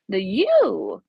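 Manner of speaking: a hint of a campy movie witch on "The you"; Sanderson sister. Tarot reader joking about her own line, mock witch voice. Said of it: "felt like a Sanderson sister when I said that".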